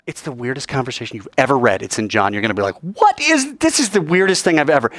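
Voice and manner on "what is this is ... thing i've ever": baffled. Acted. Listener's baffled reaction acted.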